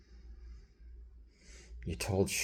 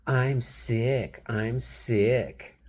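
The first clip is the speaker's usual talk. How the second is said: higher, louder mocking whine; 7 semitones higher, 8 dB louder, much slower than usual. Retelling how his wife mocked him, loud mocking whine. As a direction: parrot her mockery with exaggerated whining. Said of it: "you're mocking me".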